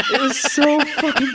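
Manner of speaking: cracking up, high-pitched and loud; laughing. Real, not acted. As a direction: barely get it out through laughter. Cracking up at own story.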